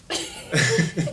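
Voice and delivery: loud, high burst of laughing; helpless laughter. Real, not acted. Colleagues cracking up over the lesson, loud laughter.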